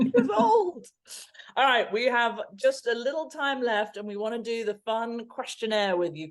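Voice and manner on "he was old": high, loud excuse through laughter; laughing protest. Real, not acted. Player excusing herself for not knowing him, laughing protest. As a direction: squeal it out while laughing.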